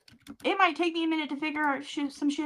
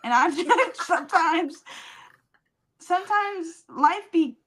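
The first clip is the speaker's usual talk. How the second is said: high, slow and giggly; laughing; 6 semitones higher than usual. Real, not acted. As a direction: drag it out through laughter. Laughing musing about life.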